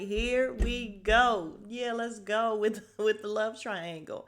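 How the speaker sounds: slow, loud, eager; drawn-out excitement. Real, not acted. Vlogger relishing the love triangle, slow and loud. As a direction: savour the drama coming. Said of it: "And I was just like".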